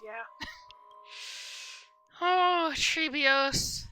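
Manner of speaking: normal talking voice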